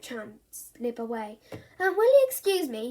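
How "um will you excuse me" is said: higher, louder polite request; boy excusing himself; politely. Acted. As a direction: slip away politely. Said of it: "he said politely".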